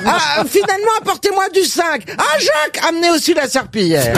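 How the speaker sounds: very high, almost squealing call; astonished punchline call. Acted. Pharmacist calls for the mop, very high excited cry. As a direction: exclaim it to the stockroom, giggling at the end.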